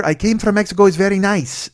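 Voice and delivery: high, loud mock Mexican accent; heavy Mexican accent. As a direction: play the passenger with a thick Mexican accent. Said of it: "she had a really heavy accent".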